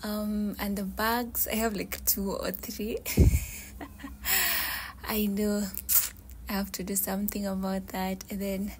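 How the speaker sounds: soft whisper all through, with a breathy laugh here and there; whispered room tour. Real, not acted. Showing viewers her shoes and clothes, in a soft whisper.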